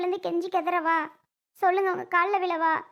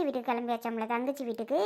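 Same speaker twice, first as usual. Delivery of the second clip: sarcastic mutter; 5 semitones lower, 6 dB quieter than usual. Acted. Wife's sarcastic aside about husband.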